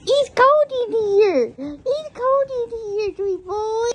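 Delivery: slow, high cooing to the dog; slow high pet-talk croon. Real, not acted. Baby talk to shivering dog.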